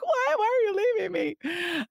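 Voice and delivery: very high whiny wail; mock whiny leader. A hurt leader to departing people, high mock whine. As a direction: ham up a hurt leader's cry. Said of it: "They're like".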